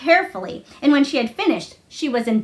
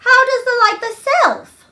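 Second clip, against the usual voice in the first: much higher, louder curious question; 12 semitones higher, 5 dB louder than usual. Acted. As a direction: ask it truly curious. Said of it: "she inquired".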